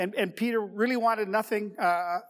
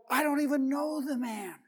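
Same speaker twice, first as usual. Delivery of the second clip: sworn denial; 6 semitones higher than usual. Retold or imitated. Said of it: "began to curse, and he swore an oath".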